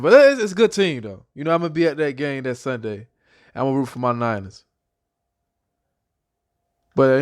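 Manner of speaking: ordinary talk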